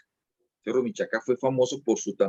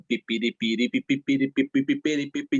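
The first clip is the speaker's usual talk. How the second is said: rasgueo rápido de tiple con piri y pi, con la boca; tiple más ágil imitado; 3 semitones higher than usual. Retold or imitated. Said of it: "la evolución comenzó pim". (imitando el tiple evolucionado con la voz)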